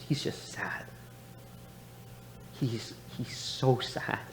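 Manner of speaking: "He's just sad" whispered, "he's so sad" slow and soft; whispered and slow, remembering his father's sad face; hushed whisper. Real, not acted. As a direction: drop to a whisper in sorrow.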